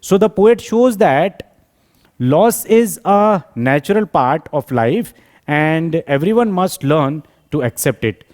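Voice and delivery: very slow, stretched words with pauses; slow dictation. Acted. Teacher reading what the poet shows, slow dictation. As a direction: read it out phrase by phrase. Reads summary aloud slowly.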